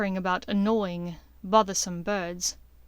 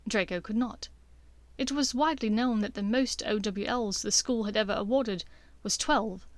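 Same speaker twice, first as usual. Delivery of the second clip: usual speaking voice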